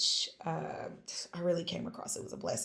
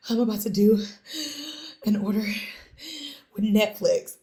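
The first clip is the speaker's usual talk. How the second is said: excited announcement; 3 semitones higher, 4 dB louder than usual. Retold or imitated. Telling sister the big news.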